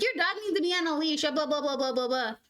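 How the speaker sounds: mock angry city dweller. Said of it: "city folk who lose their mind".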